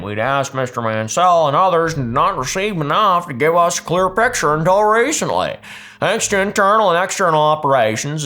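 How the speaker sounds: lightly put-on Utah accent reading a CEO statement; mild mock Utah accent, formal and polite. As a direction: read it like a Utah executive, a bit of Utah in the vowels. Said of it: "That's my best Utah accent".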